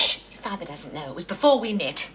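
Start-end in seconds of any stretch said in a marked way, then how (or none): 0.0-1.1 lower, softer and quick; hushed confiding. Acted. Mother hushing daughter about an old boyfriend, hushed and hurried. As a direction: hush it like a secret from father.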